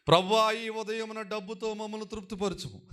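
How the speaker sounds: high-pitched mock prayer for money; mock wrong reading. Shown as a demonstration. Preacher parodies how the congregation should not read the prayer, high mock reading. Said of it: "అలా చదవకూడదు మీరు".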